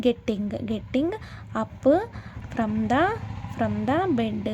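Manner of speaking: "getting... up... from the... bed" broken up with pauses; word-by-word pronunciation. Shown as a demonstration. Teacher showing learners how to pronounce "getting up from the bed", slowly piece by piece. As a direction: sound out "getting up from the bed" piece by piece, repeating parts. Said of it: "అని pronounce చేస్తారనమాట".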